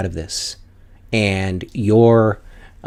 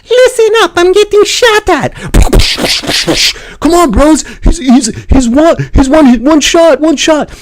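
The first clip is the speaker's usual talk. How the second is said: high, loud squeaky gamer callout; frantic gamer callout; 9 dB louder than usual. Retold or imitated. Imitating a teammate under fire, high frantic callout. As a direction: yell it like a panicking squadmate. Acting teammates calling out in-game.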